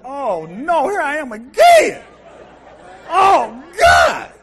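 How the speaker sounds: high, loud, slow wail of dread; anguished shout. Retold or imitated. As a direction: groan out in mock despair. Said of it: "I see y'all like".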